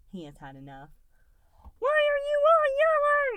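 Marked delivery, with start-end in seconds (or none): 1.8-3.4 much higher, loud, slow little-creature cry; overwhelmed blob shouting over the crowd; cries out. A narrator's reading. Goo crying out at the yelling crowd, loud and high. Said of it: "he cries out".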